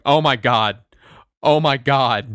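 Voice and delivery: loud mock astonishment, repeated; loud retold disbelief; imitating astonished wife. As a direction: act out her stunned exclamation. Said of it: "just kept going".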